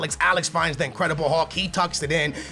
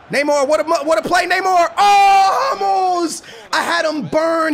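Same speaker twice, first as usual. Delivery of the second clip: loud, high excited yelling; excited shout; 8 semitones higher, 5 dB louder than usual. Real, not acted. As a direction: yell it out in excitement. Big play excitement.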